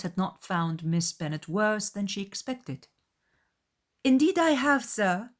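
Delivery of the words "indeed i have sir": high, loud fussy mother; Mrs. Bennet's voice. A narrator's reading. Mrs. Bennet answering Bingley about Jane, high and emphatic. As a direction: gush it out as the anxious mother. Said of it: "was her answer".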